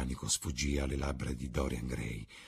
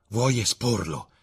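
slow, raised, alarmed question; exclaimed disbelief; 7 semitones higher, 6 dB louder, slower than usual. A narrator's reading. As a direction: ask it drawn out, aghast. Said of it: "esclamò Dorian Gray".

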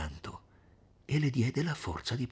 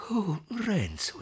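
slow, high-pitched dismayed cry; pleading, higher exclamation; alarmed exclamation; 10 semitones higher, slower than usual. A narrator's reading. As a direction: draw the name out in high alarm. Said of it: "esclamò Lucia".

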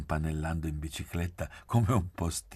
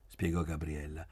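ordinary delivery, nothing marked

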